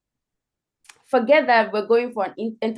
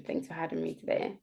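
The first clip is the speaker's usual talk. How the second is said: soft thanks; 3 semitones lower, 11 dB quieter than usual. Shown as a demonstration. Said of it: "That progression".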